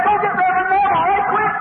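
acted urgency. Preacher acting out a deathbed call for help, urgent. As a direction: send for help in a mock panic.

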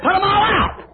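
command. Acted. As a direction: order them all out. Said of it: "So he said".

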